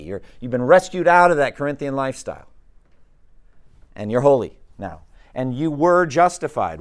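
rousing preacher voice; fervent preaching. Acted. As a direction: proclaim it to the class. Preaching with rising force.

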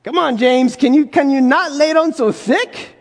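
usual speaking voice

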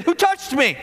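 fairly stern. Retold or imitated. Jesus turning on the crowd, a touch stern. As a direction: ask it with mild sternness. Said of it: "fairly sternly".

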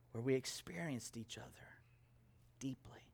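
unvoiced hushed whisper. Preacher quoting Jesus, whispered. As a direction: breathe it out like a secret.

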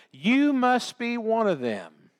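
high, drawn-out accusing quote; retold accusation. Accuser pointing Peter out.

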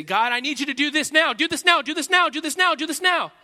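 high, loud, urgent pleading; insistent shouted demand; frantic demand. Retold or imitated. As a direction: demand it over and over, rising panic. Impatient demand voiced to God.

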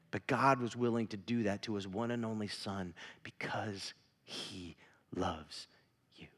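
tender hushed close; soft whisper. Acted. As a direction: let it sink in quietly. Preacher hushes on key line.

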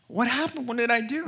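high, fretful questions; mock-worried, pitched right up; panicky husband. Husband to his crying wife, high and panicky.

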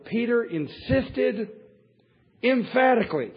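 loud, high and slow on INSISTED EMPHATICALLY; emphatic. Retold or imitated. Preacher hammering Peter's boast from the verse, emphatic. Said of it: "How do you say anything more strong".

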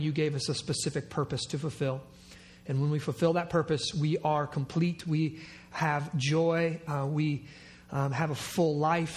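hushed, low prayer voice; low reverent pastoral prayer. Acted. Opening prayer before sermon.

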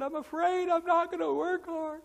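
put-on high child voice, worried; little boy. Son Ethan answering his dad, in a high worried child voice. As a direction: voice the scared five-year-old. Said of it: "He said".

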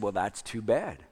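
low, slow mock sympathy; sarcastic. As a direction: imitate a wife's sarcastic brush-off, drawn out. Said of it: "she says it so sarcastically".

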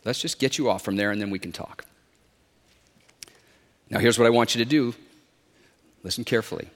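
steady him with measured words; calm instruction. A narrator's reading. The Lord instructs man on the branch, calm low voice.

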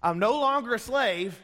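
mock the proud Israelites again with a wink; preacher mocking Israelites' boast, high and loud; mocking freed slave. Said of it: "Let me go back over here".

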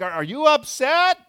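yelled question. Retold or imitated. Spouses yelling across the house.

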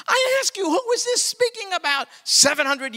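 loud, high, challenging the crowd; preaching shout. Acted. Preacher to congregation, loud challenging call. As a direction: call it out to them.

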